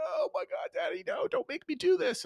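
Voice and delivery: squeaky, very high begging voice; panicked child. Retold or imitated. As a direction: play the boy panicking in the car seat. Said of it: "He's like".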